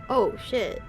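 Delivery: normal talking voice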